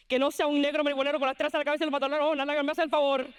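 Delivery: rapid-fire Spanish scolding; warning grandmother. Acted. Abuela warning granddaughter whom not to marry, fast rant. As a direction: rattle off the warning without pausing. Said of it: "she always warned me".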